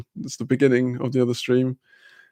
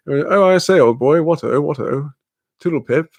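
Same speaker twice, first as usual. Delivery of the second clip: mock upper-class British gent; posh toff; 2 semitones higher, 4 dB louder than usual. Streamer spoofing a monocled smoker with cigarette holder, posh toff. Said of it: "wearing a monocle and a top hat".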